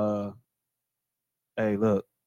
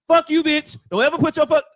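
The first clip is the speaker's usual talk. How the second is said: higher-pitched acted confrontation; mock angry outburst; 6 semitones higher than usual. Man acts out the wrong response to her, mock angry. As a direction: play at cursing someone out.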